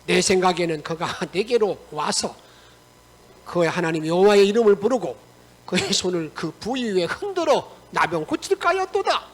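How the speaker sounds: loud, forceful acted indignation; angry scripture reading. Naaman storms off from the prophet, read in loud acted anger. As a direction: read the complaint aloud at full, heated volume. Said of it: "나만이 노하여 물러가며 이르되".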